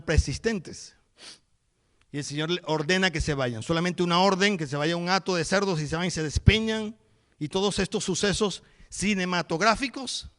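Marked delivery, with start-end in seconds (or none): none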